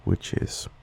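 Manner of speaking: normal talking voice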